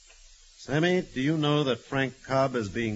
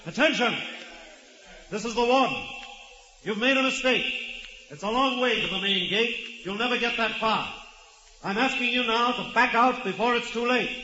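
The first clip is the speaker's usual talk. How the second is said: raised, higher voice carrying across the court; over a loudspeaker; 7 semitones higher than usual. Acted. Warden calls to rioters over the microphone, raised and firm. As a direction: address the prisoners through a microphone.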